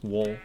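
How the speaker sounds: "wool" sounding like "wol"; own pronunciation. Shown as a demonstration. Player confirming their own way, "wool" as "wol". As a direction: say "wool" as w plus "ol". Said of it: "I say wool".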